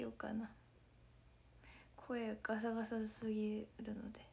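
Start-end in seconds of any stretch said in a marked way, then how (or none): 2.0-4.2 whispered through a worn-out voice; real strained whisper after shows; hoarse voice. Real, not acted. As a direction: spare a hoarse throat with a whisper.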